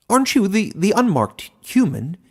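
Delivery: a little animal baffled by the man; confused hedgehog. A narrator's reading. Hedgehog puzzled at the unmarked human, high and confused. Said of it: "seeming confused".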